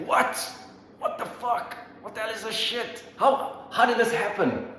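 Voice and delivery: high squeaky mock panic; shocked high voice. Americans reacting to nine eleven in high squeaky panic. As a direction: squeal it out like a startled cartoon.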